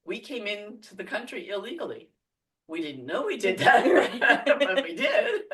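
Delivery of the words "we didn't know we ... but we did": laughing it out, louder and slower; amused disbelief, laughing as she talks. Real, not acted. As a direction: laugh through the confession. Admitting illegal entry, laughing.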